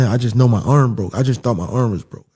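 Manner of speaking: normal talking voice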